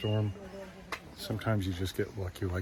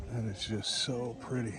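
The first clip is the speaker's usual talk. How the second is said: hushed admiring; 6 dB quieter than usual. Real, not acted. Admiring hidden flower.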